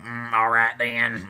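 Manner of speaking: drawn-from-film Southern character voice with a grunt; Sling Blade impression. Co-host quoting Sling Blade to friends, Southern character impression. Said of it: "Sling Blade?".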